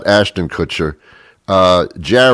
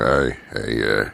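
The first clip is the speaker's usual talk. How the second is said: shady street dealer; 8 semitones lower, 5 dB quieter than usual. Pretend. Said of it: "I look for people with big heavy coats".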